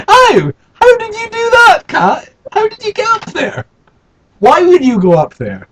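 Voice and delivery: squeaky amazed voice. Acted. Asking the cat, squeaky voice.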